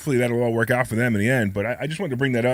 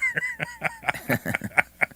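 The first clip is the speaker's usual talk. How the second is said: high squeaky laugh; 4 dB quieter than usual. Real, not acted. Laughs at own voice.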